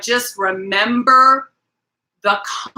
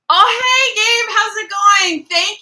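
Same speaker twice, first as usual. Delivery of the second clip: excited greeting; 12 semitones higher, 5 dB louder, slower than usual. Real, not acted. Greets a new viewer in chat.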